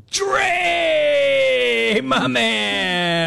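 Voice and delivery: high, long-drawn hearty shout; calling out greeting. Real, not acted. Radio host hails a favorite caller, calling out. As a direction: draw the name out in a high holler.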